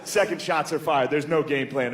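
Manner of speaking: usual speaking voice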